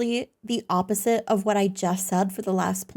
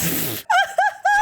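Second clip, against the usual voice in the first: high squealing laugh; shrieking laugh. Real, not acted. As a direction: burst into a shrill laugh.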